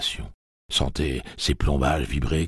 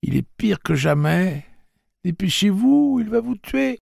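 petite voix effrayée; 6 dB louder than usual. A narrator's reading. Petit Blaine avertit, effrayé.

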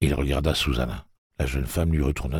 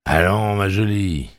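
surly; 4 semitones higher than usual. A narrator's reading. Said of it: "lança Eddie d'un ton rogue".

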